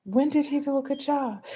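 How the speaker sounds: high, disbelieving challenge; incredulous. Retold or imitated. Driver challenging rider about the coach, high incredulous. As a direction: ask it like you can't believe it. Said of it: "I said".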